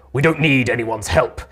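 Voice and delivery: lightly voiced gruff man; a touch of weary gruffness. Weary man rebuffs an offer of help, lightly gruff. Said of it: "is gruff and weary".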